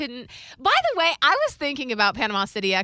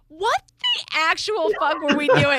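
retold disbelief; 7 semitones higher, 3 dB louder than usual. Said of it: "I was like".

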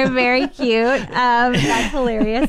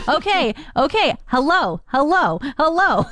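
mildly higher, building greetings; dad greeting the dog; 7 semitones higher than usual. Retold or imitated. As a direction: greet the excited dog, rising each time. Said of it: "he would be like".

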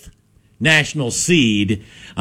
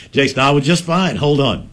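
ordinary talk

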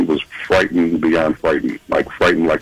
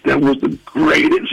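awed excitement; 8 semitones higher than usual. Retold or imitated. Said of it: "he's shaking beyond".

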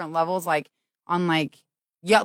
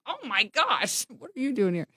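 mock surprise; 2 semitones higher, 8 dB quieter than usual. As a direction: play the startled recognition softly. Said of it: "And I was like".

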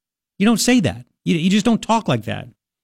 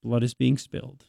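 quiet, low sneering repeat; mocking echo; 4 semitones lower, 8 dB quieter than usual. Host mocking the student's phrasing, quiet sneer. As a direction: echo the phrase back with disdain.

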